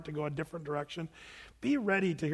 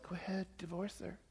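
soft, smooth, coaxing near whisper; smooth tempting voice; 15 dB quieter than usual. Pretend. Pastor imitating Satan's smooth, gentle voice, soft and coaxing. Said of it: "it sounded like this".

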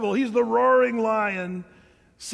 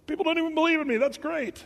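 higher, gloating mock Satan; 8 semitones higher, faster than usual. Preacher voicing Satan, pleased people don't believe, mock gloating. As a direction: play Satan pleased nobody believes in him. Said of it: "that's Satan".

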